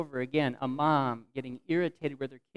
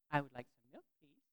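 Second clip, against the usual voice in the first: put-on child repeating politely; 9 dB quieter than usual. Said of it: "I think he said it right away".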